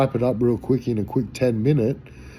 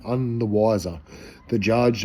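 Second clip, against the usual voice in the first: usual speaking voice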